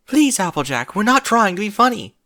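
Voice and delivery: high, loud urgent plea; pleading. A narrator's reading. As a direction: beg her to believe you. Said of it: "Rarity pleaded".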